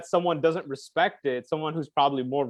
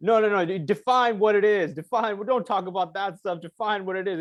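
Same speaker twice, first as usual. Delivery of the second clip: higher-pitched, animated retelling of an impatient demand; agitated interrupter; 6 semitones higher than usual. Retold or imitated. Host pressing his guest to define it, mildly agitated. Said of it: "kind of agitated".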